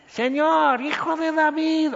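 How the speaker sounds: high, pleading woman's cry; a woman calling out high for mercy; desperate plea. Retold or imitated. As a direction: beg him as a desperate mother. Woman crying out to Jesus.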